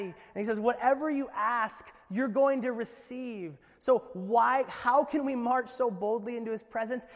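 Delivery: loud, high and bold; full-force preaching. Real, not acted. Pastor urging the church boldly, loud. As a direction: preach it out with fire.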